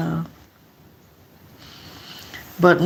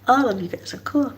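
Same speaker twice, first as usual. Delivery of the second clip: whisper; 6 semitones higher than usual. Real, not acted. Aside to viewers.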